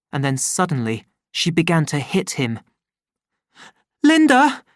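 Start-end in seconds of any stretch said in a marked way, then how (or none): 4.0-4.6 high, loud frightened call; crying out. Acted. The boy cries out to his mother as she hits him. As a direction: cry her name as she hits him. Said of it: "he cried out".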